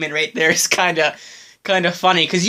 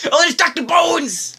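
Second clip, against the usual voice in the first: excited; 12 semitones higher than usual. Real, not acted. Fan spotting a favourite character on screen: excited shout. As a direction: shout out in thrilled recognition.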